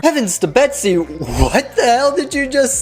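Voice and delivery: shocked disbelief. Real, not acted. Commentator stunned by the preacher's words, high laughing disbelief. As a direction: gasp it out in amused shock.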